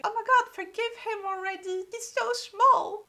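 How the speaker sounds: high, loud pleading squeal; pleading pity. Retold or imitated. Reader pleading with Inara to forgive him, high squeal. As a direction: beg on his behalf, voice way up. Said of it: "I was just like".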